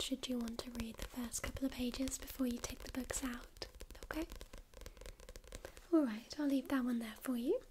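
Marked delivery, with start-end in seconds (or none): none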